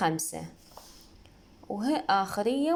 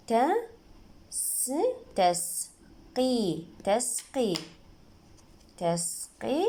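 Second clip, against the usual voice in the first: spelling out; 2 semitones higher, much slower than usual. Shown as a demonstration. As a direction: separate sounds built into تسقي. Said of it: "نجي نتهجى الموضوع". (تهجئة بطيئة)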